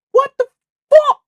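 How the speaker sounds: shocked shout. Real, not acted. Streamer sees his setup glitch, shocked high yell. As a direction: yelp it out in disbelief.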